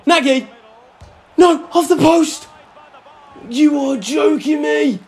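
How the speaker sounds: high, loud rising yell that breaks into despair; real anguished outburst; frantic shout. Real, not acted. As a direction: call the chance, then cry out at the post. Late chance hits the post.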